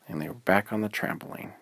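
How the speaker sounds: plain reading voice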